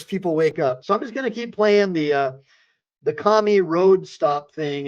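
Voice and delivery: usual speaking voice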